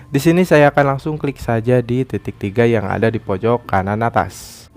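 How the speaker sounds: ordinary talk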